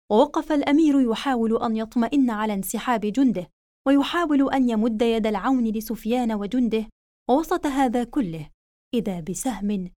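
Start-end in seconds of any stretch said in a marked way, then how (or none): none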